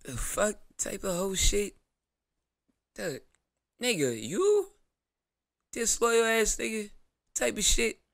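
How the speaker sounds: drawn-out, wounded disbelief; stunned betrayed reaction. Pretend. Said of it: "I walk in and I'll be like".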